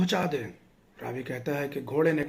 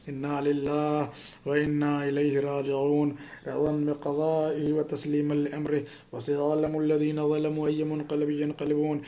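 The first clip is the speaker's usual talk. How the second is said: prayer recitation; slower than usual. Acted. Closing Quranic prayer recitation.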